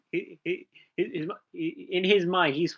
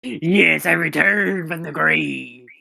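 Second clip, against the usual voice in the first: booming ideological slogans; 6 dB louder, slower than usual. Acted. Player voices returned commissar.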